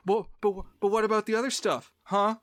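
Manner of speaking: high, hurried, defensive sputter; mock insistence. Duped ghost investigators defending their case, mock-indignant protest.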